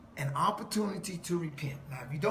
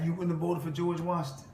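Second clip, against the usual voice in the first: ordinary talk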